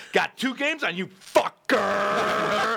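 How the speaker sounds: high, drawn-out competitive jeer; gloating taunt. Retold or imitated. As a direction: taunt the loser, stretching the last word. Acts out taunting backgammon winner.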